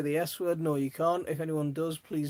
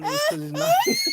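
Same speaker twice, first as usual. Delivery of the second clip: laughing shout; 10 dB louder than usual. Real, not acted. Cracking up at own joke.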